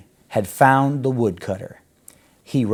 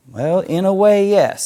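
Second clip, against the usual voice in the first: high, loud and sly; knowing, teasing agreement; sly wolf; 9 semitones higher, 7 dB louder than usual. Acted. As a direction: say it with a sly toothy grin. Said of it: "with a sly, toothy smile".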